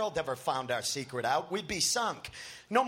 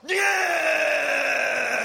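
wild, much louder high scream; 11 semitones higher, 8 dB louder than usual. A narrator's reading. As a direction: let out a feral scream. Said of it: "Tim screams".

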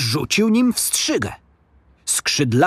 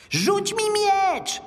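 high, drawn-out squeaky plea; very high creature yell, held back in volume; creature's cry; 3 dB quieter, much slower than usual. A narrator's reading. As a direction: cry out slowly as a little goblin. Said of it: "krzyknął stworek".

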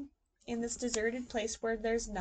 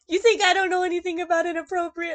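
amused aunt; 7 semitones higher, 12 dB louder than usual. Retold or imitated. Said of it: "she goes".

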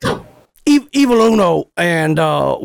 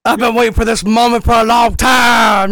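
triumphant shout; 5 semitones higher than usual. Real, not acted. Gloating prank reveal.

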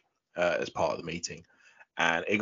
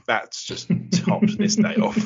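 breathless, high giggly whisper; half-laughing under breath; 13 semitones higher, 5 dB louder, slower than usual. Retold or imitated. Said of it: "And I went".

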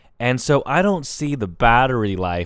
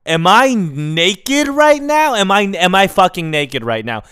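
hammed-up shock at finding yourself naked; mock shocked; 6 semitones higher, 5 dB louder than usual. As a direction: act like you just noticed in horror. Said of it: "I'm like".

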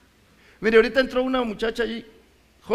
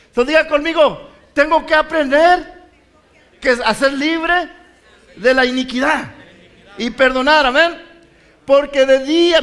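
preaching at full force; 5 semitones higher, 8 dB louder than usual. Acted. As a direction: draw the church into the words. Preacher leads repeat-after-me.

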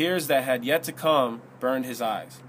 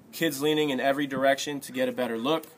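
ordinary delivery

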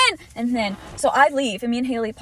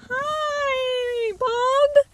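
very high, drawn-out mock squeal; hammed-up, piercingly high hello; exaggerated greeting; 13 semitones higher, 3 dB louder than usual. Woman re-enacting greeting her ex, squealed and hammed up.

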